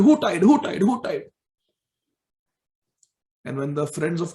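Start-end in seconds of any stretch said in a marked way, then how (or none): 0.0-1.3 high, loud repeated demand; insistent asking. Acted. Balram questions everybody about who tied Krishna, high and loud. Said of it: "he's asking everybody".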